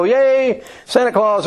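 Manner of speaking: put-on high, excited child's cheer; children greeting Santa at the parade, high and bright; kids cheering. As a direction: call out like a delighted kid at a parade. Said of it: "and they go".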